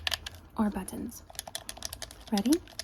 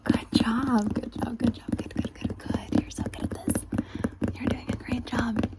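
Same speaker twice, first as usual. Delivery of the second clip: ASMR whisper. Acted. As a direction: whisper praise close and gentle.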